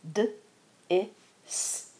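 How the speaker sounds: D, I, S said as separate sounds; sounding out dis. Shown as a demonstration. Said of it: "The first syllable is dis".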